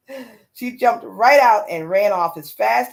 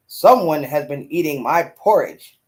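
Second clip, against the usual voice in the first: lower, louder Papa Bear growl; great big Papa Bear voice; 5 semitones lower, 5 dB louder than usual. A narrator's reading. Storyteller reading Papa Bear to listeners, low and loud. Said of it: "said in his great big voice".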